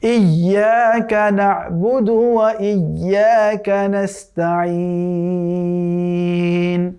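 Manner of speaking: slow, melodic tajweed chant drawn out at the end; chanted Quranic recitation. Shown as a demonstration. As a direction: recite the verse once, slowly, with full tajweed. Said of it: "আমার সাথে আপনারা practice করতে পারেন".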